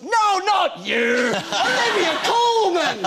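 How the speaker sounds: very high, slow, loud shout through laughter; old man's shout. Retold or imitated. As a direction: bellow it like a delighted old fan correcting him. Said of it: "He goes".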